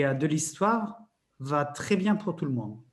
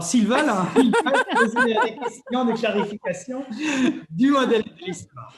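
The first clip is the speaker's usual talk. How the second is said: words bubbling out through real giggles, pitched up and louder; laughing while talking; 8 semitones higher, 6 dB louder than usual. Real, not acted. Explaining the workshop steps to co-host, through real laughter. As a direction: let the laugh break through every phrase.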